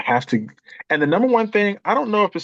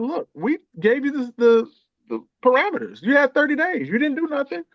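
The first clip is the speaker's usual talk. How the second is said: a lightly voiced firm mother, pitched higher; mother matter-of-factly telling her son his time is up, lightly voiced higher; mother laying down law; 10 semitones higher than usual. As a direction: calmly explain the rules he didn't follow, as mom.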